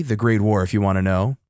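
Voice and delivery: usual speaking voice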